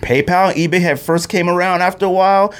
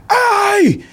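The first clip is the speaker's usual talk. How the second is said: high-pitched, drawn-out yell; yelling security guard. Retold or imitated. Mall security guard hailing someone walking by, yelling. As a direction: call out sharply, way up in pitch. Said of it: "He was yelling at me".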